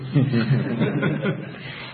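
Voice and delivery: short amused laugh. Real, not acted. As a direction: chuckle at his own punchline. Laughs at own joke.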